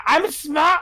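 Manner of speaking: high-pitched mock shout; small character's high holler. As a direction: call out high into the distance. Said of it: "I'm just gonna shout out".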